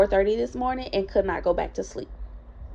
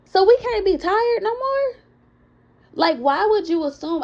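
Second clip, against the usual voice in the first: incredulous; 9 semitones higher than usual. Retold or imitated. Venting about being judged tired.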